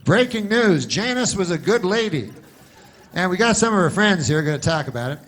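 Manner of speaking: hammed-up anchor hyping a trivial story; breaking news anchor. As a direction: announce it like big breaking news.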